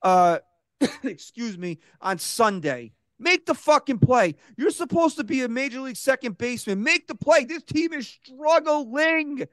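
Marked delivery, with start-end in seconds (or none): 3.2-7.4 angry rant. Real, not acted. Ranting to the cohosts about Gleyber's missed play, angry and raised. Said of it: "I wanna rant about this". 7.5-9.4 "struggling" stretched slow and high with an extra syllable; drawn-out emphasis. Real, not acted. Stressing to the cohosts how badly the team struggles, stretched slow and high. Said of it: "I added an extra syllable there for emphasis".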